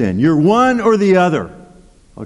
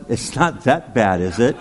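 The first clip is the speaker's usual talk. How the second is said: sheepish quip; 6 semitones lower, 4 dB quieter than usual. Retold or imitated. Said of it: "all I could think to say was".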